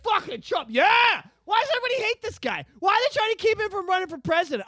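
high-pitched, quick retold outburst; imitated rant. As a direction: act out a stranger getting worked up. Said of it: "just starts going off with me".

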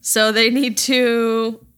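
usual speaking voice

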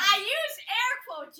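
loud, exasperated comeback; defensive shout. Acted. Cellmate answers the accused prisoner's outburst, shouting defensively. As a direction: shout back to be heard.

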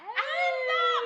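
sudden high shout across the street; calling out. Retold or imitated. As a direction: yell a friend's name, high and loud.

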